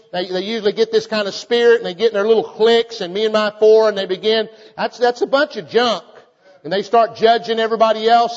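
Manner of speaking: loud, high preaching of the verses; preaching scripture. Acted. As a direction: read the scripture as a thundering sermon. Preaching at full force.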